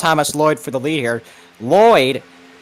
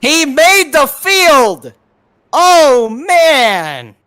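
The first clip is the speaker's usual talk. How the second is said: high loud slow disbelieving cry; excited shout; 9 semitones higher, 6 dB louder, much slower than usual. Real, not acted. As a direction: yell it in amazement. Co-commentator reacts to finish.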